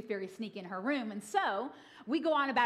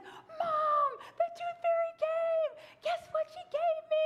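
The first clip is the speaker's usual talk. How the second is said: put-on thrilled little girl; slower than usual. Excited daughter voiced.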